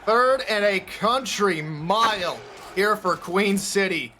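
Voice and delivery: ordinary talk, nothing marked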